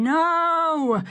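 high sharp shout. A narrator's reading. Fourcade charges at Renard, shouting high. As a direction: yell it as he charges up the steps.

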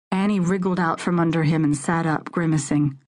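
plain reading voice

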